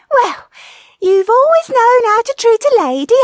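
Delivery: very high, loud retort; loud high-pitched wifely sarcasm; sarcastic wife. A narrator's reading. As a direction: call back in a high comic wife voice. Wife retorts to husband's taunt.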